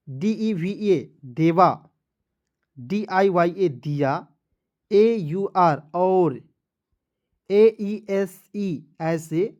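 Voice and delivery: usual speaking voice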